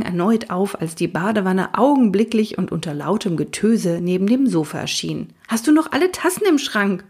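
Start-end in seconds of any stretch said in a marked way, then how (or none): 5.5-7.0 fast, high mock yell at the unicorn. Fred scolds Magic over the whirlpool, a fast high yell. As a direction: snap it out, outraged but not full volume. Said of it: "brüllte er Magic an".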